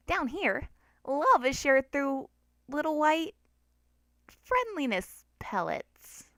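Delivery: a cute flower hiding a trap; sweet tricky flower. A narrator's reading. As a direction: lure the child in with slow sweetness.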